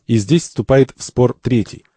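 normal talking voice

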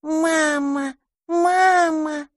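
put-on high, tender baby-dragon coo; a newborn dragon cooing at its mama, high and tender. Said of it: "нежно проворковал".

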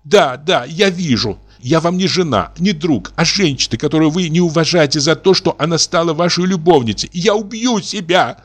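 high, sobbing despair; рыдающий высокий голос; tearful. A narrator's reading. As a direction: weep out the threat. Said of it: "продолжала она, рыдая".

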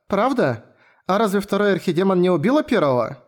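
put-on a small curious girl asking; young girl voice. (голос маленькой девочки)

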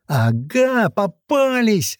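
high, drawn-out gloating call; triumphant boyish cry. A narrator's reading. Boy catching the intruders, crying out in triumph. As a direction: crow it high, stretching the last word. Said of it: "торжествующий мальчишеский крик".

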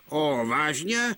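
slow, sarcastic oh really; drawn-out scoffing disbelief; mocking exclamation. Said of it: "zvolal Donald". (pomalu, posměšně)

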